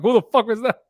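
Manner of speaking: high, loud startled yelp of a reaction; startled outburst. Retold or imitated. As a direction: replay the jolt when the dog barked. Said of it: "I'm like".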